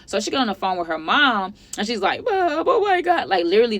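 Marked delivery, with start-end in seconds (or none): 2.2-3.3 high, drawn-out mock wail; wailing panic imitation. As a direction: mimic a hysterical daughter crying to mom. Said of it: "this is how she sounded".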